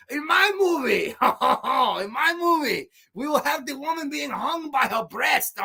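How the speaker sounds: high-pitched, hammed-up Italian accent; horrible Italian accent. Imagined Italian director outdoing rivals' cannibal movies, hammed-up Italian accent. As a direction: put on a terrible Italian accent and crow it, high and over the top. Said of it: "I'll do a horrible Italian accent".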